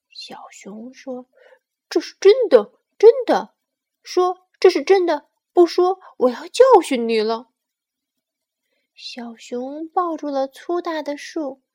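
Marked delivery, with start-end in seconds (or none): 1.9-7.5 very loud, high, threatening demand; angry insistence. Acted. Bear demands sparrow say it's true, threatening. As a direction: shout it as a furious bear cub.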